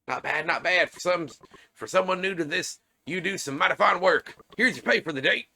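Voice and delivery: game character praising. Acted. Boss pays a newcomer for the day's work, praising. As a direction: deliver it as a loud, upbeat boss handing out pay.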